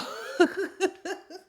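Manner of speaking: high giggle; real laugh. Real, not acted. Laughing at the names she gave her routine, real giggle. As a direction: let out an amused, bubbling laugh. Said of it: "I'm laughing because I actually".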